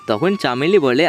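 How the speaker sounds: ordinary delivery, nothing marked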